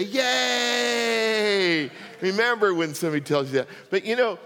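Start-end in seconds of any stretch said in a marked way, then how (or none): 0.0-1.9 cheer. Pretend. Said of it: "And I want you to say".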